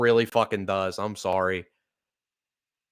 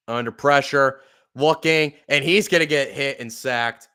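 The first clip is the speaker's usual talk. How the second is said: live play-by-play; 3 semitones higher, 7 dB louder than usual. Acted. Fan narrating the Chiefs drive for viewers, energetic play-by-play. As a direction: call the drive like a broadcaster, picking up pace at the end.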